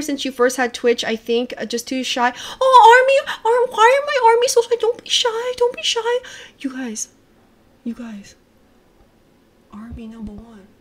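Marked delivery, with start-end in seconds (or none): 2.6-6.0 very high, cutesy squeal; squealed coaxing. Real, not acted. Streamer coaxing shy fans in chat, squealed coaxing. 6.6-10.7 hushed, barely audible cheer; whispering. Real, not acted. Streamer confiding in her Army fans, whispering.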